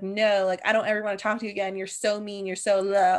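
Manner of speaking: normal talking voice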